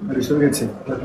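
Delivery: thanks spoken away from the mic, barely heard; faint, off mic. Real, not acted. President thanks the room before his mic is on, faint and distant.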